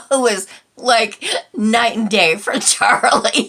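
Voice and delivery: amused laughing comparison; real laugh. Real, not acted. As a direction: burst out laughing mid-sentence. Laughing about new cat.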